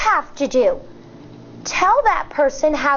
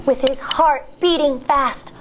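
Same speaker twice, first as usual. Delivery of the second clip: ordinary delivery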